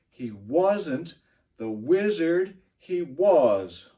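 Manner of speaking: slow, measured limerick reading; teacher reading aloud slowly. Shown as a demonstration. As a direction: read each line slowly for the class. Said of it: "I'll read it slowly".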